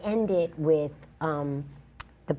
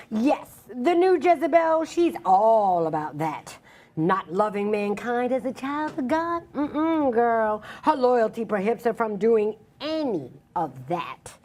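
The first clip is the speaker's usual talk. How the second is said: slow, declamatory, proud recitation; spoken-word poem; 5 semitones higher, 3 dB louder, slower than usual. Acted. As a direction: stretch key words and lift them defiantly. Resumes performing her poem.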